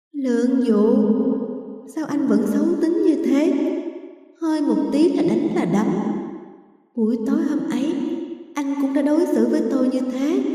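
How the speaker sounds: high, soft mocking ghost girl; eerie ghost voice. A narrator's reading. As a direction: coo like a taunting spirit. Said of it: "Giọng nói của con gái lại vang lên".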